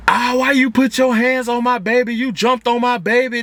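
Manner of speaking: high, heated mother's accusing voice on the phone; upset mom yelling down the line; mock angry mother. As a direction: play the furious mama accusing him.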